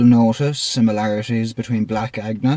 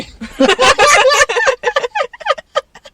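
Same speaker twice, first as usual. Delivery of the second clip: big squealing laugh; high shrieking laugh; 7 dB louder than usual. Real, not acted. Big laugh at KFC pun.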